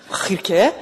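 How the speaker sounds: usual speaking voice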